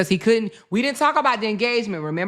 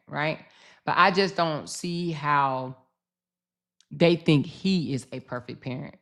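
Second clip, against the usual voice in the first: usual speaking voice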